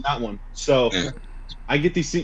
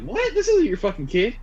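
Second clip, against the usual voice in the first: incredulous; 10 semitones higher than usual. Retold or imitated. Retold disbelief to the mom.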